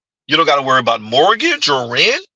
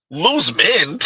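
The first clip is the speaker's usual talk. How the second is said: normal talking voice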